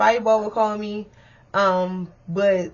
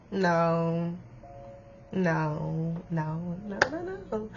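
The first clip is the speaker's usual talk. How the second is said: fading no; 3 semitones lower, 8 dB quieter, much slower than usual. Real, not acted. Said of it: "denying braces, fading out".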